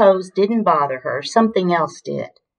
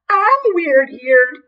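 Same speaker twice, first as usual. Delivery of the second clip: high, loud, drawn-out wail; offended wail; 11 semitones higher, 5 dB louder, much slower than usual. A narrator's reading. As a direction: stretch it into a hammed-up hurt cry. Offended hippo wails at insult.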